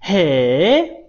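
long, loud "heee?" swooping up; exaggerated amazed interjection; demonstrated surprise. Shown as a demonstration. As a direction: draw it out into an astonished rising question. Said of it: "它是一个很惊讶的感觉".

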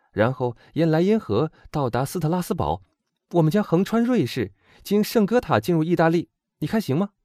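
put-on high, brisk-spirited young woman's voice; confident young heroine. A narrator's reading. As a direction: lay out an escape plan eagerly. Voices Eugénie laying out the route.

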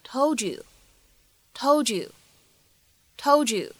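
"told you" run together as "tol-joo"; linked assimilation. Shown as a demonstration. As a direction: merge the d and y in "told you" into a j sound. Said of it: "做一个音的同化的处理". (“told you”同化连读成“tol-ju”)

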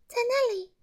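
high elf voice; bright high fairy voice; little elf pointing. A narrator's reading. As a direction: show him the spot. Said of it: "小精灵指着树上的一个小洞说".

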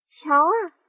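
high, loud shout; aunt shouting at the peach. Acted. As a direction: call out to look. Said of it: "喊了起来".

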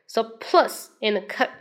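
normal talking voice

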